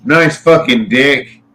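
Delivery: slow mock Aussie accent. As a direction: drawl it like an Aussie neighbour. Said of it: "that's how it sounds too".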